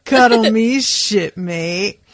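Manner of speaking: mock drunk, loud then laughing; playful tipsy plea, half laughed; drunken cuddle. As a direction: lurch in for a drunken hug. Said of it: "sort of staggering".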